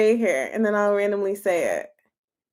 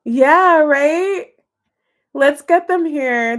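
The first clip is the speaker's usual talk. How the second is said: ordinary talk, nothing marked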